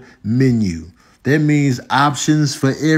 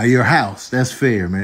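ordinary talk, nothing marked

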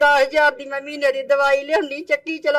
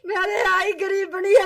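higher-pitched real wail; crying; 5 semitones higher than usual. Real, not acted. Poor flood victim lamenting to visitors, crying. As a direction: wail it through tears. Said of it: "ਕੋਈ ਗੱਲ ਨਹੀਂ, ਰੋਣਾ ਨਹੀਂ।".

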